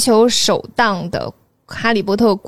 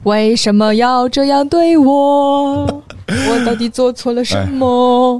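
mock despair; 3 semitones higher, slower than usual. Mock despair at quiz question.

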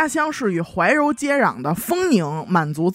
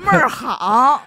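put-on slow, drawn-out Yi County Hebei accent; slow Yi County Hebei praise; 4 semitones higher, 4 dB louder, much slower than usual. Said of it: "还有一个说易县的".

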